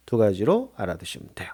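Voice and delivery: ordinary delivery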